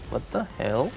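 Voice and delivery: usual speaking voice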